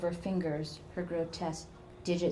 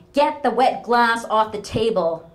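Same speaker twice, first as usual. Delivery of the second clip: shouted much higher and louder, sharp and bitter; angry snap; 5 semitones higher, 13 dB louder than usual. Acted. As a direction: bark it at her daughter like an old tyrant. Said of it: "her mother snapped".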